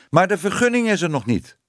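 hoog nageroepen door de kat; naroepen. A narrator's reading. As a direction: roep het iemand achterna. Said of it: "riep de kat haar achterna". (hoog achterna roepen)

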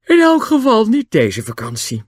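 high, loud yawn through the words. A narrator's reading. Julian rules out Kirin this holiday, yawning. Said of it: "En hij gaapte".

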